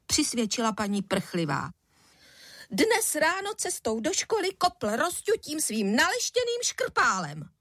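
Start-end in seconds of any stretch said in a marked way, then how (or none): 2.7-7.5 very high, outraged complaint; fuming mother, swooping pitch; indignant tirade. A narrator's reading. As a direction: accuse in a worked-up, high-pitched voice. Angry mother's tirade of accusation.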